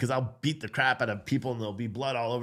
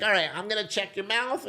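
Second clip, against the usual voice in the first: put-on doctor to child; 7 semitones higher than usual. Doctor voiced to the boy.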